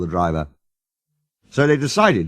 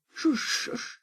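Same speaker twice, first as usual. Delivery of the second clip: whisper; 11 dB quieter than usual. Acted. Hushing near famous old engine.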